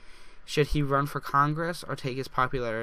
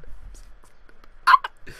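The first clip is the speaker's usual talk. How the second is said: much higher, louder shriek of joy; excited shriek; 4 dB louder, faster than usual. Real, not acted. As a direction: let it burst out as a squeal. Bursting with excitement.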